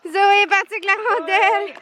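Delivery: high, bright repeated call; excited. Real, not acted. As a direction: shout it out delighted.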